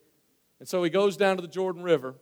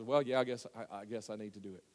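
low, quiet, reluctant giving in; acted reluctant agreement, trailing very soft; grudging concession; 9 semitones lower, 13 dB quieter than usual. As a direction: sheepishly admit it under your voice. Naaman grudgingly gives in.

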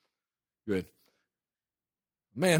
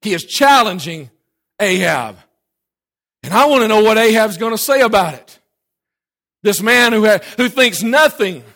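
full-force preaching; 7 semitones higher, 11 dB louder than usual. Real, not acted. Preacher challenging the room over Ahab's answer, full-force preaching. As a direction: preach it loud, leaning on the big words.

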